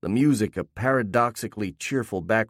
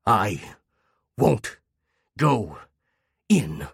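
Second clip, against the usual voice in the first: one sharp snap per word, pauses between; barking, growling speech; much slower than usual. A narrator's reading. Talking dog defying the owner, snapping word by word. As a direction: bark each word out separately. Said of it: "a sharp snap of noise, some frightening amalgam of barking".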